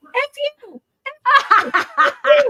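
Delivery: very high parrot squeak; high-pitched mimicked parrot, breaking into laughter; imitating cursing parrot. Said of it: "and it would go".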